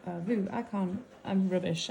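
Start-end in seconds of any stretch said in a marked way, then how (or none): 0.1-0.5 slow French with a northern English accent. Real, not acted. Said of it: "I've got such a northern accent when I try to say French".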